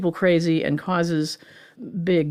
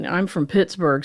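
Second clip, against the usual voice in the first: usual speaking voice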